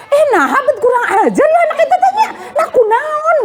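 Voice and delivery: hammed-up furious mom, half laughing; a parent flaring up at the news; overreacting mother. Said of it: "Bukan begitu".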